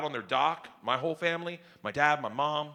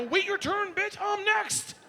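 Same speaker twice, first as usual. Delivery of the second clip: higher, mock-brusque; mock impatient; slower than usual. Dad telling his daughter to wait her turn, mock brusque. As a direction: shoo her off.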